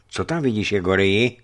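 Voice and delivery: usual speaking voice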